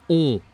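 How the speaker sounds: loud "on" as one nasal vowel; nasal sound. Shown as a demonstration. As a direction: hold "on" through the nose, no n. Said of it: "in French a nasal sound".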